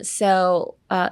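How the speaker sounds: usual speaking voice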